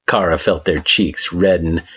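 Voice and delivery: ordinary delivery, nothing marked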